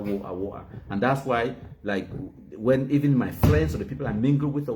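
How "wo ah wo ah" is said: "wo-, ah" for water, t dropped; mock "wo-, ah" with a gap for the t; mocked Cockney pronunciation. Scorning those people's talk, wo-ah with no t. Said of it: "Those people who talk that".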